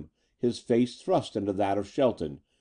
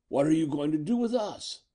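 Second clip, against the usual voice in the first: higher-pitched defiant question; defiant, voice raised in pitch but not volume; bravely defiant; 6 semitones higher than usual. A narrator's reading. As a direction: put up a brave front, pitch lifted. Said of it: "his voice bravely defiant".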